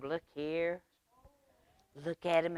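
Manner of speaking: usual speaking voice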